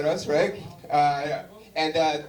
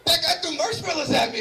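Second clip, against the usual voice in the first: high, whiny tattling to a cop; mock whiny complaint; 7 semitones higher than usual. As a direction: play the petty victim calling the officer.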